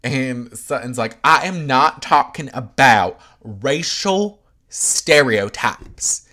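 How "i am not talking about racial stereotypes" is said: mock an angry refusal to go there; emphatic shout. Sutton's heated refusal.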